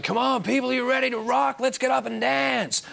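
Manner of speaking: hammed-up stadium rocker geeing up the crowd; rock frontman. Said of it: "coming out and going".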